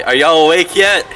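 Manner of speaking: loud, high shout through a window; calling out. Acted. Nox at the window to the others, calling out. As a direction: holler it up to sleeping friends. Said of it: "go to the window and say".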